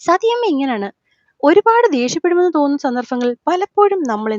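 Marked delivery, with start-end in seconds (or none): none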